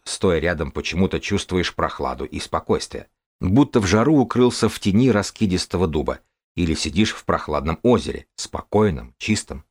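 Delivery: ordinary delivery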